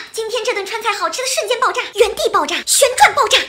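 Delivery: building to an excited shout; excited gushing. Acted. Gushing fan hype, building.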